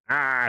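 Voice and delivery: long held "aah" mimicking a god; imitated drawn-out "ah". Streamer describing that sound voice, drawn-out imitation. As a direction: draw out the "ah" in imitation. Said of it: "sound voice".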